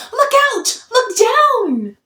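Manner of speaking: high, loud warning shout; urgent yell. A narrator's reading. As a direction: warn them in alarm. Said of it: "who yelled".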